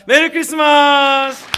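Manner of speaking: long, loud, high holiday cheer; festive shout. Real, not acted. Preacher rousing applause for Jesus, loud drawn-out cheer. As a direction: shout it out slowly to rouse applause.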